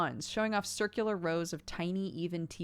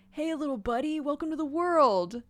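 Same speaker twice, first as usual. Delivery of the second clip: higher, cooing voice for a tiny creature; tender baby talk; 8 semitones higher, 5 dB louder than usual. Acted. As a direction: coo at a newborn pet. Cal greets newborn creature.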